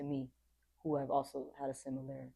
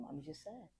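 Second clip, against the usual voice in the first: quiet admission; 11 dB quieter than usual. Retold or imitated.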